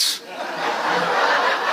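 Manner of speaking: silent breathy laugh; breathless laugh. Real, not acted. Speaker laughs at his own punchline, breathless. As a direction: laugh without voice at the joke.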